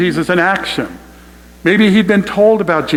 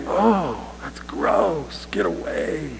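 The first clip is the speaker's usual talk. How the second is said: disgusted crowd; 9 dB quieter, much slower than usual. Retold or imitated. Crowd voiced recoiling from leper.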